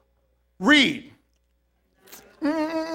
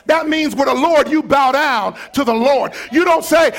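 loud, high shouting sermon; full-force preaching; 8 semitones higher, 9 dB louder than usual. Acted. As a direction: preach it hard to the congregation.

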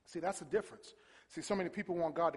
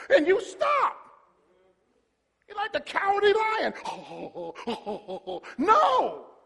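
very high, loud scolding shout; full-force preaching; 10 dB louder, slower than usual. Real, not acted. Preacher rebuking those who stop, full-force preaching. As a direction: cry it out high and loud, landing hard.

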